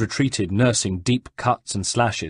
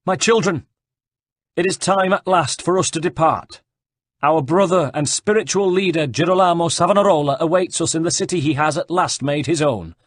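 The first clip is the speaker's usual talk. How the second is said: a raised, higher herald voice addressing a crowd; preaching herald; 8 semitones higher, 4 dB louder than usual. Acted. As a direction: proclaim it to the faithful as a herald.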